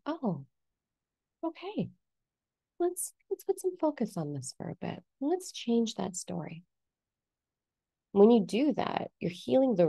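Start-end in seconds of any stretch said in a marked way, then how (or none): 0.0-6.6 high, soft, gentle voice; tender self-talk. Acted. Coach to own fear once found, high tender reassurance. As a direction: soothe softly, pitched up high.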